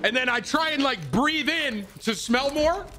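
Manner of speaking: very high, animated storytelling; worked-up retelling. Real, not acted. Streamer swearing to chat it happened this morning, worked-up retelling. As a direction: insist on it in a high, incredulous pitch.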